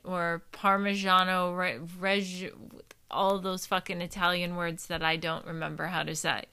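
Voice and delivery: ordinary talk, nothing marked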